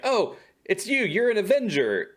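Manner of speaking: high, wide-eyed gasp of recognition; freaking out. Retold or imitated. Agnes freaking out on recognizing Vision, mock frantic. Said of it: "she starts freaking out".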